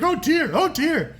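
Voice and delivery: high, slow, wavering fretting; mock dismay in a high swooping voice; fretting onlookers. Bystanders around a scuffle, high fretful mock dismay. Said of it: "everybody around you is kind of like".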